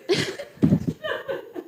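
high, breathy burst of laughter; breathless laugh. Real, not acted. Old scientist laughing at her own quip about plumón. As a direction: crack up at her own joke. Laughs after Gore-Tex joke.

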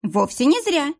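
a light smirk, pitch up. A narrator's reading. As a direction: smirk through the words. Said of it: "усмехнулась француженка".

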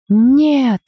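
long, high yelled "no". A narrator's reading. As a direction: scream out in protest, drawn out. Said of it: "заорала Дебби".

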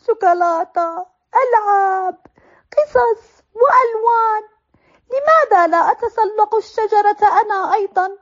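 put-on high, whining child's complaint; jealous little brother. Zaid under the table envying Jood, whiny self-pity.

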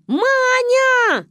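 высокий громкий зов; кричать подругу со двора; заорали. Acted. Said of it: "заорали мы". (громкий оклик)

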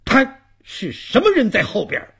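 high, loud shout behind him; startled old man's loud challenge. Acted. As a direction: call out sharply to whoever is following. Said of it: "老汉朝后边喊了一声".

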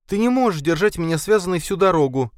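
high indignant woman's voice; indignant protest. A narrator's reading. Linda, bound, protesting to Khalid, indignant. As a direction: protest with outrage while tied up. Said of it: "возмущалась Линда".

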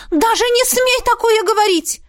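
high, urgent exclaiming mother. Acted. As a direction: cry out in alarm as mother. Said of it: "воскликнула мама". (мама восклицает, высоко и настойчиво)

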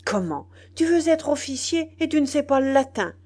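loud, high, angry tirade; indignant outburst. A narrator's reading. General berating a would-be officer, furiously indignant. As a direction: cry out in outrage, climbing high and loud. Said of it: "une subite expression de colère et d'indignation".

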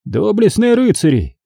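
high-pitched declamation to a hall; booming address. A narrator's reading. Magister calling drunken knights to attention, booming oratory. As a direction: proclaim it grandly, pitch well up. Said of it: "загрохотал Генрих".

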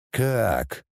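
low, doomed question; resigned. A narrator's reading. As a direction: ask it dreading the answer. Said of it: "обречённо спросил владыка". (обречённо, низким голосом)

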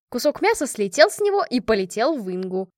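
ordinary delivery

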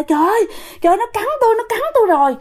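high-pitched acted cry of pain. Great-grandmother cries out as the ghost bites her, alarmed. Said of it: "la quá trời".

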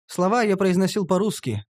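ordinary delivery, nothing marked

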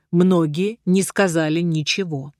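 usual speaking voice

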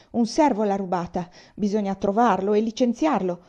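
put-on old general fussing to his son about a stolen medal; petulant old man. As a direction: grumble like a spoiled old man.

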